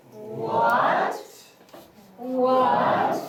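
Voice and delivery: "What" drawn out and rising; second tone. Shown as a demonstration. Teacher drilling second tone, long rise. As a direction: stretch "What" upward into a question. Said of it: "The second tone".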